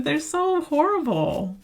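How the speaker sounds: disgusted. Real, not acted. Disgust at the person.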